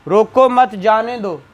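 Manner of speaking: रोको मत जाने दो run together as one phrase; no pauses. Shown as a demonstration. Teacher reading the example without punctuation, all in one unbroken run. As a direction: read रोको मत जाने दो straight through, no break anywhere. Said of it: "जो आप पढ़ेंगे without punctuation".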